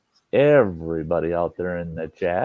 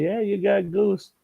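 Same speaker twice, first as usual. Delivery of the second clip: teasing; 11 semitones higher than usual. Retold or imitated. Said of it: "I'd say".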